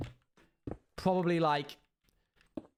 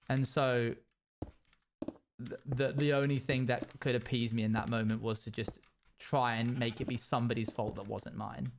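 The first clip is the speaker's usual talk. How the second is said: ordinary delivery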